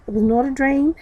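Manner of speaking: ordinary delivery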